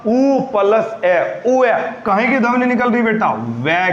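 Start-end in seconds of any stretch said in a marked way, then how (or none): 0.1-1.4 ऊ plus ए said very slowly and calmly; slow vowel blending demo; calm slow sounding out. Shown as a demonstration. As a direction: sound it out gently, unhurried. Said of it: "शांति से बोल के देखना पड़ेगा".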